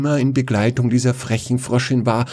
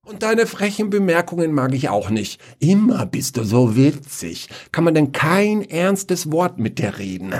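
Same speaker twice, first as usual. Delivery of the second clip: higher, exasperated scolding; annoyed complaint; 7 semitones higher than usual. Acted. Prince scolds the frog for her jokes, exasperated. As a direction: snap back, fed up.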